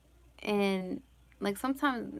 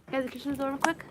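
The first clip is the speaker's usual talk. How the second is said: calling out again; 5 semitones higher, much faster than usual. Real, not acted. Mom calling her daughter Yazzie to shut the door, raised voice. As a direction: repeat the request, calling her by name.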